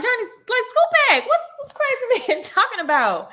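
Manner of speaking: high, urgent warning to scoot back; squeaky panicked aside to her daughter; alarmed. Retold or imitated. Warning daughter about strange man.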